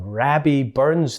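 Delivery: Rabbie with a short flat a; Scottish Rabbie. Shown as a demonstration. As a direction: say Rabbie the Scots way, not Robbie. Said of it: "we don't call him in Scotland Bobby Burns or Robbie Burns".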